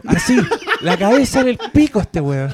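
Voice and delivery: high-pitched laughing complaint; laughing outburst. Real, not acted. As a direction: squeal it through laughter. (gritando entre risas)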